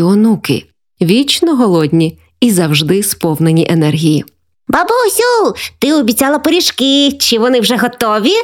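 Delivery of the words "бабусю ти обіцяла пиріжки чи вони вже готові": put-on high child's voice calling from the doorway; be an eager grandchild calling for pies; grandkid calling out. A narrator's reading. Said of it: "пролунало ще з порога".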